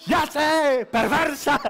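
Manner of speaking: laughing mock husband. Husband accusing wife of plotting, laughing. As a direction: bark it through laughter.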